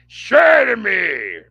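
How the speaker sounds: slow, loud raspy hero yell, pitch up; Batman growl shout. Retold or imitated. Streamer mocks Bale's Batman voice, slow hammed-up shout. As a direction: bark it out like a movie vigilante. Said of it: "and the Bat voice get, gets on my fucking nerves".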